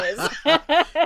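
slow, drawn-out shout with a laugh; loud emphatic reply. Retold or imitated. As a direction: shout each word out slowly. Acting partner's exasperated-sounding reply.

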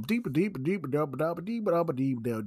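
high, bouncy mock beat; beatboxing a silly beat; mock beat sounds. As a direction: scat the beat mockingly. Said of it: "go straight back to".

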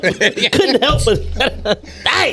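laughing high and slow. Real, not acted. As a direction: laugh through the words. Laughing at copay story.

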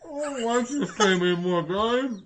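talking through a mouthful; mouth full. Real, not acted. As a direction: say it with food in your mouth. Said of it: "he's talking with his mouth full".

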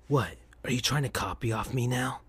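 mocking Shadow. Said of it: "Shadow laughed and smiled".

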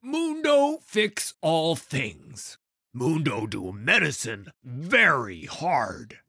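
slow, high brute chant; hulking doctor reciting his vows; Mundo's voice. Acted. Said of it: "The words of his medical vows".

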